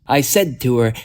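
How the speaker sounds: usual speaking voice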